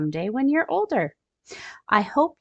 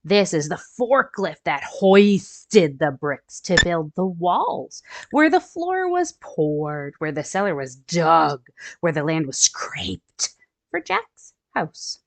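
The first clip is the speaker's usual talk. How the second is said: storybook read-aloud for little ones; gentle picture-book narration; reading to children; 3 semitones lower, 3 dB louder than usual. A narrator's reading. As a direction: read it like story time for kids.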